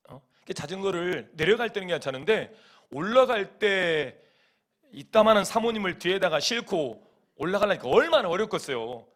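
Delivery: ordinary delivery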